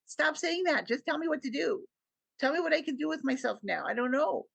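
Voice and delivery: retelling snapping at him in frustration; frustrated outburst. Retold or imitated. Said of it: "even get mad at him".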